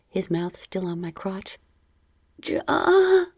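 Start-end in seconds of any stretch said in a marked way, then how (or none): 2.4-3.2 high, loud mewing yes; mewed. Acted. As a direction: let it slip out high like a mew. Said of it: "I mewed".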